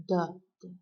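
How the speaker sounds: loud first "da", weak second "da"; strong-weak beat on "da, da"; trochaic stress. Shown as a demonstration. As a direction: punch the first "da", drop the second. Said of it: "stressed syllable and ends with an unstressed".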